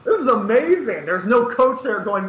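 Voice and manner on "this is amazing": laughing, slow and higher, savouring each word; mock self-admiration. Mocking lazy players who only admire their own plays, laughing and drawn out. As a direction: ham up a smug player gloating over his own replay.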